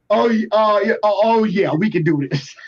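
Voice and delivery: impressed. Retold or imitated. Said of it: "he was just like".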